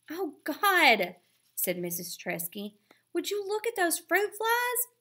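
exasperated at the flies; weary mother spotting fruit flies; dismayed mom. A narrator's reading. Tired mom exclaims.

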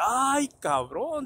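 high, drawn-out exclamation; mock-amazed friend; admiring exclamation. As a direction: stretch it out high, impressed. Friend admiring the new sandals.

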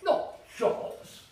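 high, loud feigned astonishment; mock surprise. Waiter eyeing the boy's chops, mock surprise. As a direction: pretend to be amazed.

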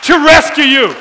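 loud, rising preacher's cry; full-force preaching. Acted. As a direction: drive it home to the congregation.

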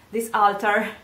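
ordinary talk

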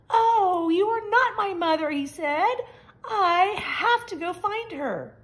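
high, loud, a touch of crying; disappointed baby bird. A narrator's reading. Baby bird finds the snort isn't mother, high and tearful. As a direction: let the hope fall away.

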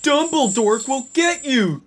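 high-pitched threatening cry; Draco. A narrator's reading. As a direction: warn him in a high voice. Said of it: "Draco shooted".

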